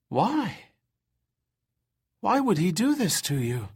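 high, disbelieving question. Acted. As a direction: ask in shocked disbelief. Injured woman asks in disbelief.

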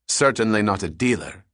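usual speaking voice

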